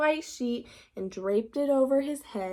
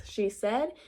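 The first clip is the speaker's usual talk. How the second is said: usual speaking voice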